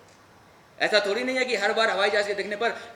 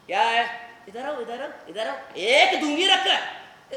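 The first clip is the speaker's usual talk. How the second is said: a hint of mum's heavy, stern voice; mock-scold like mum, slowing down and laughing; imitating mum; 3 semitones higher, 5 dB louder than usual. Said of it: "उन्हीं की तरह भारी आवाज". (माँ की भारी आवाज़ की हल्की नकल, हँसते हुए)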